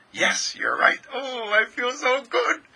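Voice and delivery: eager yes rising into high gushing; delighted reply. Acted. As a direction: agree eagerly, then soar up in delight. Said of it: "do you instantly respond".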